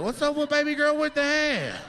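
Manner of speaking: mock a nosy coworker giggling about her hand; coworker gossiping. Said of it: "You saw her at work like".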